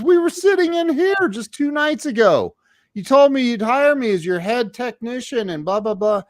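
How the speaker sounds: very high, exasperated imitation; indignant reminder. Job seeker reminds drinker of promised job, indignant. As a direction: protest in a high put-on voice.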